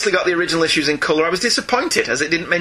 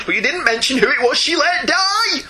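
mock indignation; 9 semitones higher than usual. Reads a listener's indignant complaint aloud.

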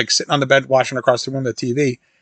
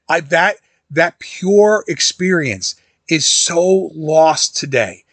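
usual speaking voice

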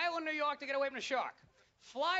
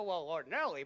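much lower, dopey-serious answer; mock-patient explainer; 8 semitones lower than usual. Filmmakers answer the puzzled viewer, mock-patient explaining. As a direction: drop low to explain it away.

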